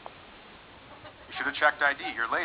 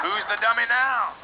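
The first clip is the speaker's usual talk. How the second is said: gloating taunt; 6 semitones higher, slower than usual. Acted. Gloating comeback.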